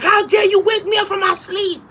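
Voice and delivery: mock outraged shout; retold angry shout. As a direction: yell it like she just woke you up. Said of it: "I was so mad".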